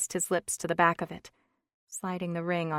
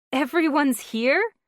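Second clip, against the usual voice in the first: higher, astonished question; surprised; 9 semitones higher, 10 dB louder than usual. Acted. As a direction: caught off guard. Wife surprised.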